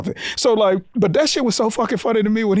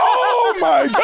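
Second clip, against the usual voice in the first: laughing disbelief; 11 semitones higher, slower than usual. Retold or imitated. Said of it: "you're like".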